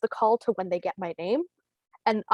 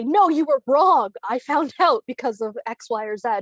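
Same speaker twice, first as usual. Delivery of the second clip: smug correction; 3 semitones higher, 6 dB louder than usual. Retold or imitated. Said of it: "they would say".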